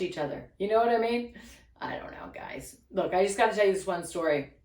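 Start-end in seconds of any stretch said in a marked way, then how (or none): 1.8-2.8 whispered aside. Real, not acted.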